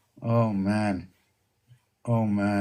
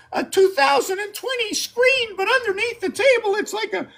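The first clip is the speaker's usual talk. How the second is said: much higher silly little character voice; squeaky comic voice. Acted. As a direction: play a tiny frantic creature hiding under the table. Squeaky figure voice.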